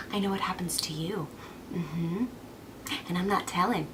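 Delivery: low, soft, secretive taunt; hushed gloating. Acted. Cassandra withholding Apollo's future, hushed taunt. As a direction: keep the secret, almost whispering.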